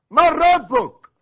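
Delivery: high, drawn-out thunderous shout; angels' shouted interrogation. Acted. Angel seizing the dead man in the grave, shouted interrogation. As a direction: roar the question slowly, as if shaking someone by the shoulders. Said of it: "ما تزعقش"، هو ده زعيق؟".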